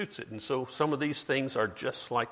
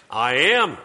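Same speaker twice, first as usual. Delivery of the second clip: proclaimed name; 10 dB louder than usual. Retold or imitated. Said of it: "What did he say his name was there".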